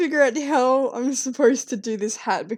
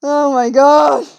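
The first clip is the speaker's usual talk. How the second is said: slow, louder, higher "oh my god"; surprised; 4 semitones higher, 11 dB louder, slower than usual. Real, not acted. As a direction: drag it out in disbelief. Sudden reaction while drawing.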